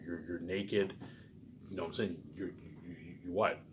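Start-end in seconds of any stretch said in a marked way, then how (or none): none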